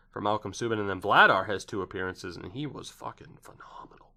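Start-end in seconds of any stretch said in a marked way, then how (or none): 2.4-4.1 breathy hushed aside; whisper. Real, not acted. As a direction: drop to a whisper for the praise. Hushed aside praising the goalie.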